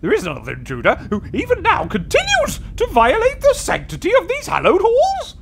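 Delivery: high, loud spirit fury; angry booming ghost. Acted. Guardian spirit scolding the intruder, high and booming. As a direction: rail at the insolent mortal.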